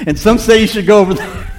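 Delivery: high, loud imitation of an excuse-maker; mock objector. Churchgoer dodging confrontation, loudly mock-complaining about churches. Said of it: "they'll say".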